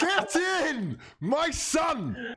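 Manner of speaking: high, cracking celebration yell; ecstatic shout. Real, not acted. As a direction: scream it with joy. Celebrating the goal.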